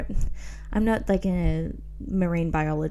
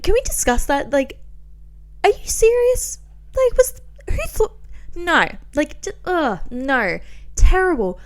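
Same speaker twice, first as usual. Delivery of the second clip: outraged disbelief; 8 semitones higher, 3 dB louder than usual. Real, not acted. Outraged at Johnny Depp casting.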